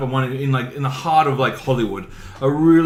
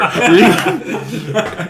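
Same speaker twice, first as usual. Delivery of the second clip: laugh; 5 semitones higher, 4 dB louder than usual. Real, not acted. Laugh inside the put-on voice.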